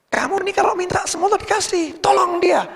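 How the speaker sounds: high-pitched acted plea; urgent pleading. Others urge the saint to help, high-pitched pleading.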